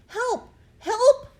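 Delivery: high, loud cry of help; boy calling for help. A narrator's reading. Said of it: "I said".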